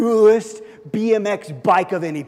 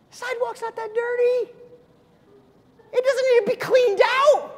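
hammed-up high-pitched child's whine; whiny pleading kid; 7 semitones higher than usual. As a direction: beg mom like a scared eight-year-old. Boy pleading with mom.

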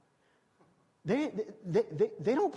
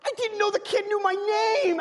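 high, excited retold thought; thrilled he knew my name; high happy surprise; 12 semitones higher, 4 dB louder than usual. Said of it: "And I'm like".

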